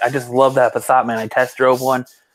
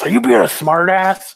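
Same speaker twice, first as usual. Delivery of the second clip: higher, louder snapping boss; annoyed boss; 7 semitones higher, 4 dB louder than usual. Retold or imitated. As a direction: snap it like an irritated boss. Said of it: "when it's your boss".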